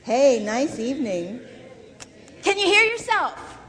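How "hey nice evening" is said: "Hey, nice evening" said louder and slow, heard back in the cupped ear; louder demo. Shown as a demonstration. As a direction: project it and listen to yourself. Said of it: "Now try both".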